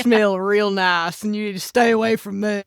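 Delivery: brooding Southern vampire voice; Bill Compton. Acted. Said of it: "You know who that sounds like? It sounds like Bill Compton".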